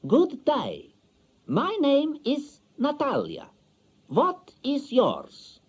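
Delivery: each word spaced like a Bond villain; slow, loud spy-film voice. Acted. Natalia greeting her new husband, slow spy-film voice. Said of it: "in a slow and loud voice that reminded me of one of them James Bond spy films".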